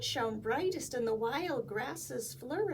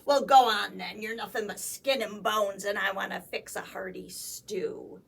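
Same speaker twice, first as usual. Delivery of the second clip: gruff troll; 3 semitones lower, 8 dB louder than usual. Acted. Troll answering goat.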